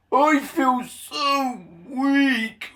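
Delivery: high, very slow feeble moan; weak and feeble. A narrator's reading. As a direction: let the strength drain from the words. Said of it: "asked Gordon".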